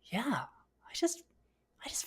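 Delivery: a drained, breathy whisper. Retold or imitated. Answering her husband after the meltdown, whispered. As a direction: barely get it out, spent.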